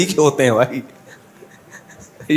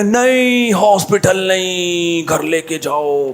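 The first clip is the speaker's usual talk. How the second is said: higher, slow, groaning protest; mock dying plea; 3 semitones higher, much slower than usual. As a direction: drag it out like a dying man pleading. Injured man refusing hospital, groaning. (ऊँची, धीमी, कराहती हुई मिन्नत)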